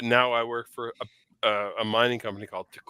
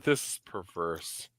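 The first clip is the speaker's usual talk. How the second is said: ordinary talk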